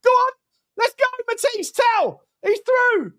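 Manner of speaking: high, urgent fan yell; excited cheering. Real, not acted. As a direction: urge the striker on as he breaks through. Cheering a live attack.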